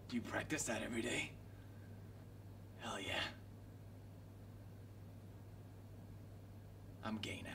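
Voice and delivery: soft and low, whispered throughout. Acted. Streamer voicing a flirty in-game encounter in a whisper. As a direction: murmur it like a secret.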